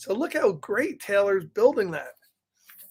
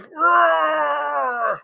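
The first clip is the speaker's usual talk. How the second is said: higher, loud mock roar; bear roar; 6 semitones higher, 4 dB louder than usual. Reader roaring as the bear for children. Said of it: "So he's like".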